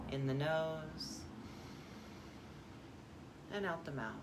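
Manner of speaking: soft, unhurried breathing cue; guided meditation. Acted. Guide paces listeners' breathing, soft meditation voice. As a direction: softly lead each breath, pausing between.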